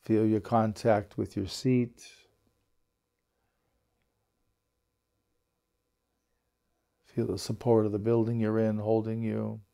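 unhurried meditation guide, long pauses between phrases; guided meditation. Acted. Teacher settling a group into belly breaths, calm guided meditation. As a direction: ease them gently into relaxing, leaving space after each instruction.

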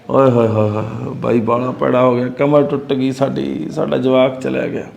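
husband rehearses fake condolence, hammed-up sad; fake mourning. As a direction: put on false sorrow for the neighbours. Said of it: "ਇਹੋ ਜਿਹਾ ਮੂੰਹ ਬਣਾ ਕੇ ਦੱਸੂਗਾ".

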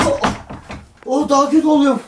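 panicked. Real, not acted. Panic getting worse.

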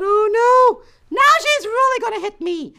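high, loud panicked thought; mild childlike panic, pitched right up; alarmed. Acted. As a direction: cry out in mock dread. Thinks sister will hit her.

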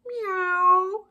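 high, stretched meow; cat meow. A narrator's reading. Cat answering Duck as he rides past, cat meow. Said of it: "said Cat".

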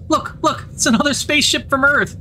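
put-on girl spotting the ship, excitedly calling out; excited exclaim. A narrator's reading. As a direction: point and shout with wonder. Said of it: "she exclaims".

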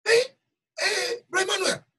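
high-pitched retold call-out; imitated excited shout. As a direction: mimic a woman shouting for the preacher. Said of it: "She was shouting".

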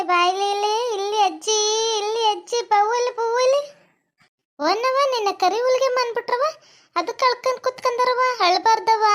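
put-on grandmother's sing-song baby talk. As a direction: coo sweetly to the little one.

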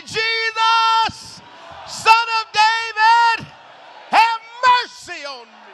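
high, loud, drawn-out "Jesus, Son of David"; loud cry for mercy. Shown as a demonstration. Preacher leading the church to cry out, loud pleading shout. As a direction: shout it like the congregation's prayer. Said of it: "open up your mouth and say".

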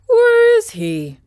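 high, slow, far-off bird voice; stork. Acted. Stork asks where the Scarecrow is, high and slow. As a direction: stretch it like a big bird.

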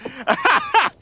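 bright laugh. Real, not acted. Laughing at a funny toy.

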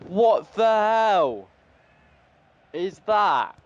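loud, high, drawn-out disbelief; exasperated outburst. Real, not acted. Gamer to own team after a bad play, exasperated. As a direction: cry out slowly in exasperation.

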